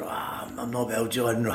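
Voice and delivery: faint, breathy fake-ill voice; hammed-up sick voice, barely voiced at the start; put-on sick voice. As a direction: play it weak and poorly down the phone to the boss. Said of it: "I put his voice on".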